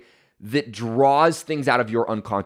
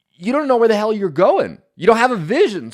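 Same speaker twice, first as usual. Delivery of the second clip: higher and louder, driving the point home; emphatic outburst; 8 semitones higher, 5 dB louder than usual. Real, not acted. Motivational speaker to his audience, loud and emphatic. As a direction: preach it hard, nearly shouting.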